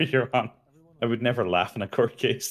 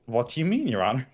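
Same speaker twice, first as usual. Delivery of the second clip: smug mock innocence; 2 semitones higher, faster than usual. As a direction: play innocent while barely hiding a grin. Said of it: "I could hear it in your voice".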